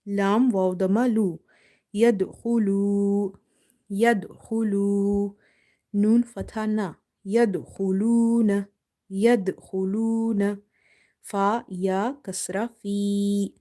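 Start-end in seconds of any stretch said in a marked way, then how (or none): 1.9-5.3 slow, drawn-out final long u; recitation demo. Shown as a demonstration. Teacher modelling the word for learners, slow recitation. 7.3-10.6 measured recitation with a long u; recitation demo. Shown as a demonstration. Teacher modelling the word for learners, measured recitation.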